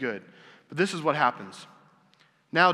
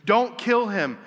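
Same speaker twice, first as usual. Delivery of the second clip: higher, louder mock cry; retold scream; 7 semitones higher, 5 dB louder, slower than usual. Real mother begging Solomon to spare her son, raised mock cry. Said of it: "the woman screams and says".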